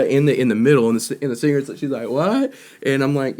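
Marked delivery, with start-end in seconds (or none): 2.1-2.5 high rising question, louder; imitated surprise. Singer turning to the band mid-song, high mock surprise. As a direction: mimic a baffled singer.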